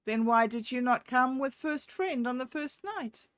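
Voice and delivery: woman challenging cat. A narrator's reading. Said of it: "Woman said".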